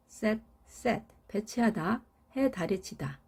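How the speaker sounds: normal talking voice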